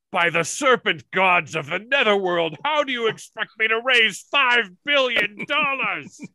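lightly voiced villain, pitch rising toward the end; hamming up a pompous serpent emperor; Serpentor quote. Quoting Serpentor to the hosts, a light mock-villain voice. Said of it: "Serpentor's quote".

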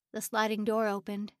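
usual speaking voice

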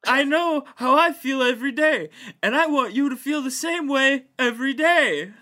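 Eric Adams impression. [name] reading the mayor's quote in his voice. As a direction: deliver it like the mayor at a podium. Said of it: "how would Eric Adams read that".

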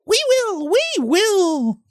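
very high, squeaky little cheer; high piping cry, held long; chorus of vegetables. A narrator's reading. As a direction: squeal it out like tiny creatures in chorus. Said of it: "cried all the vegetables in chorus".

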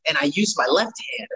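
normal talking voice

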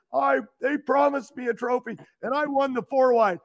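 normal talking voice

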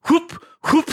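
quick vocal "whoop" sound effect; short comic movement noise; imitated swoosh. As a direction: mimic arms whipping through the air. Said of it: "his arms go whoop".

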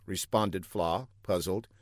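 ordinary delivery

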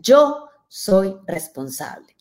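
each word slow and deliberate on "Yo soy responsable"; solemn slow vow "Yo soy responsable"; slow firm declaration. Shown as a demonstration. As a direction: lean on "Yo", then draw out "soy responsable" slowly. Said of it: "lo primero que tengo que hacer es".

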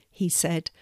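usual speaking voice

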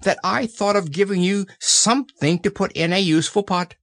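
high, small happy voice; pleased little-animal voice; happy Piglet. A narrator's reading. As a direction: chime in proudly, high and little. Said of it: "said Piglet happily".

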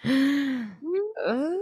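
high, soft drawn-out "ooh"; teasing, high-pitched gasp of intrigue; suspenseful ooh. Acted. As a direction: coo it in mock intrigue. Mock suspense over a message.

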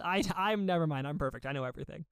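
ordinary talk

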